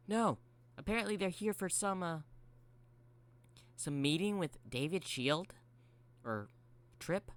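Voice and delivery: high character voice, unsure toward the end; high-voiced robot explaining; excitable Mangle voice. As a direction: pitch it up, hunting for the word.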